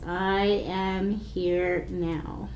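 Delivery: very slow, soft mantra on one breath; drawn-out calm chant. Shown as a demonstration. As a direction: stretch it over a full breath. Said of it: "in a nice, slow way".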